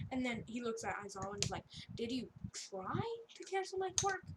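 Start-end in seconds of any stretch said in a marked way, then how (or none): 1.9-4.3 high-pitched, acted disbelief; mock incredulous question. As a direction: imitate an affronted student, voice raised in pitch. Retold student challenges his teacher.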